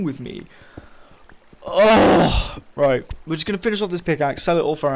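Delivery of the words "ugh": loud, high ugh; exasperated groan. Real, not acted. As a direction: groan in real annoyance. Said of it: "I'm really pissed off right now".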